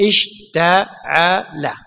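اشتعل drawn out slowly, every syllable clear: اِشْ-تَ-عَ-لَ; slow spelled-out word. Shown as a demonstration. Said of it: "صح،". (نطق اشتعل ببطء شديد مقطعًا مقطعًا)

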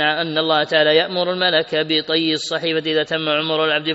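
ordinary talk, nothing marked

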